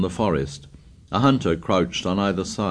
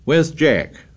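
demanding; 7 semitones higher, 4 dB louder than usual. Acted. Ralph demanding of the tribe.

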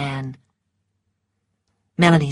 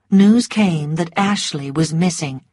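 ordinary delivery

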